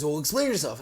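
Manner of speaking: a father insisting on an answer; stern dad. Retold or imitated. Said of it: "And he goes".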